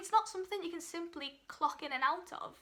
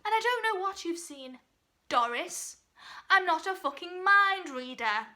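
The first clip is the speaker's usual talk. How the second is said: louder, higher irritated retort; exasperated outburst; 4 semitones higher, 8 dB louder than usual. Acted. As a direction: let the exasperation burst out at the aunt. Inner outburst at nosy aunt's question.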